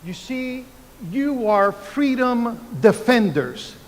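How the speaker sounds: ordinary talk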